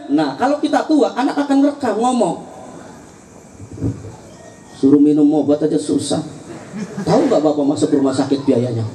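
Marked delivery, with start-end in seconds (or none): 4.8-8.8 lower, exasperated grown son; a hint of a child nagging his old father; grumbling grown child. (anak mengomeli bapaknya yang sudah tua, suara rendah)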